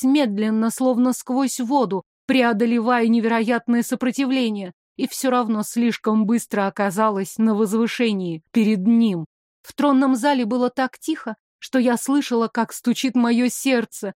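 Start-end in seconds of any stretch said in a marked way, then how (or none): none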